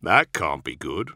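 slow, resigned fatalism; understated gloomy resignation; fatalistic. A narrator's reading. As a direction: drag it out, expecting the worst. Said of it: "Cole said with typical fatalism".

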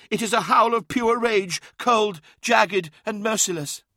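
high, intense character voice; shaken psychic. A narrator's reading. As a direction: describe the horror in a high, urgent voice. Shaken psychic describes the rage felt.

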